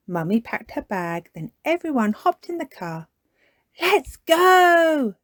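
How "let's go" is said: high, loud happy cheer. Acted. Peppa cheering as they leave, high and loud. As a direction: cheer as the car sets off. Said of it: "cheered Peppa".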